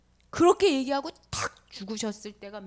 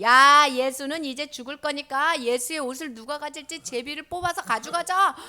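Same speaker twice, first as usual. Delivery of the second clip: loud mock crowd voice; acted crowd call; 2 semitones higher, 7 dB louder than usual. People under the cross dividing the clothes, calling out loudly. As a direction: call out to your mates, a bit louder.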